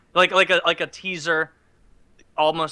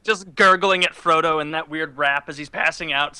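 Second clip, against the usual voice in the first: ordinary talk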